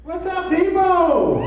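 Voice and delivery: big high slow shout to the room; shouted hype greeting. Real, not acted. Comedian opening his set to the crowd, shouted hype greeting. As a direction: fire up the crowd from the stage.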